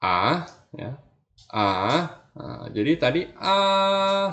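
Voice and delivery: a rising from mid to high; a slides upward; second tone. Shown as a demonstration. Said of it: "Misalnya ini".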